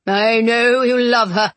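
slow, drawn-out sneer. A narrator's reading. Duke goading Mister Blackwood about Jane, sneering. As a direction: taunt him with a knowing sneer. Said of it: "he sneered at Mister Blackwood".

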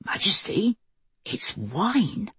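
astonished announcement; amazed. Acted. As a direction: say it in wonder. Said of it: "he announced in an amazed voice".

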